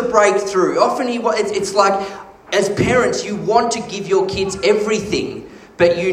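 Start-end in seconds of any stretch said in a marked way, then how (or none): none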